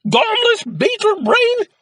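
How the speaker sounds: very high, loud, slow screech. A narrator's reading. Mother screeching at Jack over melted cheese. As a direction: drag the insult out in a shriek. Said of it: "screeched his mother".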